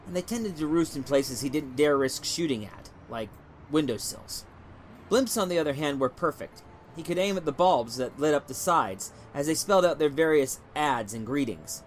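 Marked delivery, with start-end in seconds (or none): none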